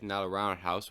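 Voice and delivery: usual speaking voice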